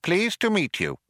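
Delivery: high, polite little mouse. A narrator's reading. Second mouse being introduced, high little voice. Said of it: "The other mouse said".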